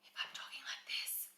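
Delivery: hushed, voiceless whisper. Shown as a demonstration. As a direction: whisper it softly. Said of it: "when we whisper".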